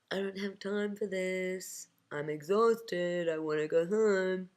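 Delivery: slow, drawn-out weary whine; whining ego. Acted. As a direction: drag every word out in exhausted protest. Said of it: "Ego went".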